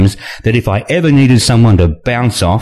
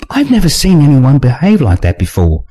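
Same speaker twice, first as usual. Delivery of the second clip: put-on higher young woman's voice; Nina voice; 6 semitones higher than usual. A narrator's reading. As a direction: raise the pitch for Nina.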